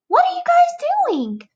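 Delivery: a wriggling little bug begging to be done; squeaky caterpillar asking again; eager caterpillar. Acted.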